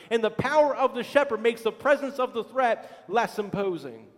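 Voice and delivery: preacher driving home the point; preaching at full force. Acted. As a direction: proclaim it to the congregation.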